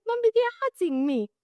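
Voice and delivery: high-pitched whimper to mommy; imitating a pleading, crying child; mock tearful plea. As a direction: plead like a hurt child, playing it up. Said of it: "you have to cry".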